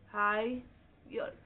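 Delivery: Higher drawn out very slowly with a rising end. Shown as a demonstration. Teacher to class, very slow rising model word. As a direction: stretch higher and lift at the end. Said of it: "अब देखो higher".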